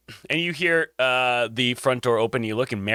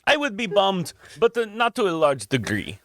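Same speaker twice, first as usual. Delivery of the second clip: Jacques; 8 semitones higher than usual. Acted.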